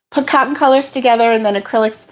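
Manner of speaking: ordinary talk, nothing marked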